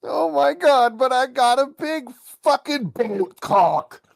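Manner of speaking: very high, whiny nasal voice; annoying nasal voice. Retold or imitated. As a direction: whine it through the nose like a loser. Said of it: "annoying, nasally voice".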